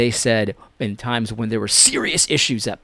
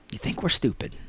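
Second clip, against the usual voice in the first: whisper; 13 dB quieter than usual. Real, not acted. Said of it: "lowered, unvoiced aside".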